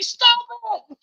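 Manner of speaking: high, loud yell. Retold or imitated. Yelling at a careless mushroom grower on TV, loud high shout. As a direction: shout it at the screen in alarm. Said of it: "I'm yelling at the T V".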